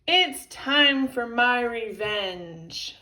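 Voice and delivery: loud, slow villainous declaration; drawn-out gloating threat; wicked. A narrator's reading. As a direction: announce revenge with a wicked smile. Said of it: "said with a wicked smile".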